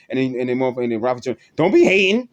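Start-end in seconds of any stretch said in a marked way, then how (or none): 1.6-2.3 louder imitated retort; mock retort. Ralphie turning on the speaker, imitated retort. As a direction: throw it back, raised mock voice.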